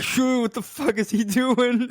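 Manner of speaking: high, drawn-out what-the-hell; startled confusion. Retold or imitated. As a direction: gasp it out, stunned at the sight. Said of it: "I was like".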